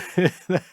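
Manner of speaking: short loud laugh; burst of laughter. Real, not acted. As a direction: laugh at the joke. Laughs at his joke.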